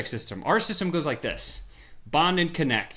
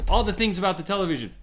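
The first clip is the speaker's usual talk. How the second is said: ordinary talk, nothing marked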